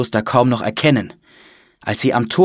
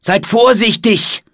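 slow, high, raised-voice caution; urgent warning; 12 semitones higher, 5 dB louder, slower than usual. A narrator's reading. Monk warns the siblings before parting, urgent warning. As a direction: warn them slowly and emphatically. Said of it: "warnte Bruder Patrick die Geschwister".

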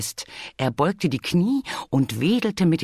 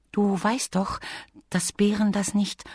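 high, light bee voice; little bee character; 6 semitones higher than usual. Acted. Bee reminds Bear what bears can't do, cautioning. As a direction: voice a small bee, patiently cautioning.

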